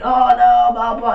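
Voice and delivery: high, loud imitation of a fluster; mock fangirl panic. Assistant cracks while acting all cool, mock panic. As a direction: wail it high, hamming it up.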